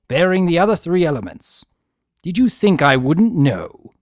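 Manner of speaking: menacing, gloating shout; rising anger. A narrator's reading.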